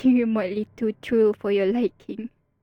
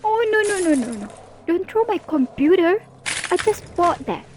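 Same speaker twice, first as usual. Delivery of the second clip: mock panic; 6 semitones higher than usual. Hero throws villain's computer.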